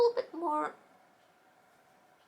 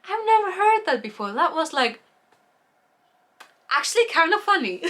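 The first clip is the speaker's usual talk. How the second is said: amused; 5 semitones higher, 5 dB louder than usual. Real, not acted. Amused by sky cocaine.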